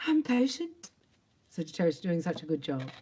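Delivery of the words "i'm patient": much higher, mock-insistent claim; mock insistence. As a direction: squeak it out, barely holding on. Mocking self-claimed patience.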